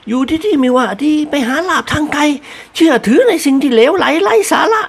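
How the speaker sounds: high, displeased mistress voice; displeased lady. A narrator's reading. Mistress displeased with servant leaving for treasure. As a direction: scold a servant you're disappointed in. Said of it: "ด้วยความไม่พอใจ".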